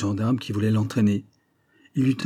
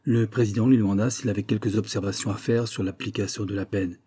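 usual speaking voice

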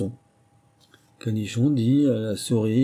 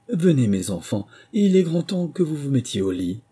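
higher, anxious mother canary; trembling; 6 semitones higher, 4 dB louder than usual. A narrator's reading. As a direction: call the young ones away with a tremble. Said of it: "d'une voix tremblante".

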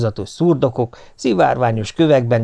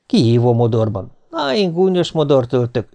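ordinary delivery, nothing marked

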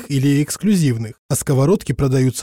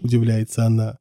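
ordinary delivery, nothing marked

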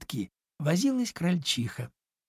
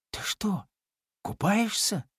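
surprised; 8 semitones higher than usual. A narrator's reading. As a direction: ask in hushed amazement, pitch up. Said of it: "удивился Чуня". (удивлённо, тоненько и тихо)

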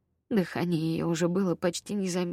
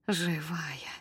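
usual speaking voice

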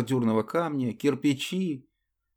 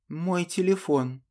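meaningful; 7 semitones higher, slower than usual. Acted. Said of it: "со значением произнесла она".

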